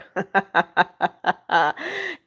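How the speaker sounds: long run of low, soft chuckles; laughing. Real, not acted. Streamer amused by a viewer's comment, laughing. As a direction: laugh it off in a steady string of ha-ha-ha.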